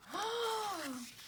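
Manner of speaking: sharp high gasp; startled storyteller gasp; dramatic gasp. Acted. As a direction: gasp for the kids as the bird dives. Bird swooping on Zoe.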